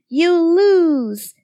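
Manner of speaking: high, sung-out gloating; high lilting victory jeer; singsong taunt. A narrator's reading. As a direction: sing it out to rub it in. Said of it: "they sang together".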